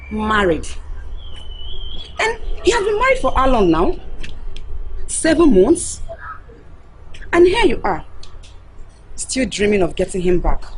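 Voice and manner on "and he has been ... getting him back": incredulous mocking. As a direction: needle her with disbelief. Mocking friend's delusion.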